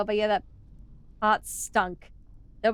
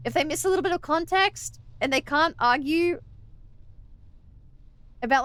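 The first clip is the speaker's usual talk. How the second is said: ordinary talk, nothing marked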